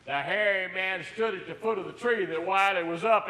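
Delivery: ordinary delivery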